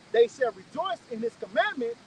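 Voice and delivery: slow, loud, emphatic scripture reading; measured, ringing recitation with pauses between phrases. Real, not acted. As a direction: proclaim each phrase slowly and forcefully. Reads scripture aloud emphatically.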